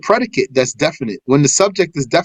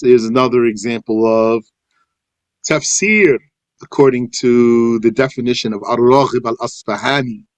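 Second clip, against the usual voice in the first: ordinary talk, nothing marked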